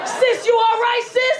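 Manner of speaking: high, loud check-in; urgent concern. Acted. Rescuer calls to woman trapped in car, urgent concern. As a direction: call out like an acted anxious rescuer.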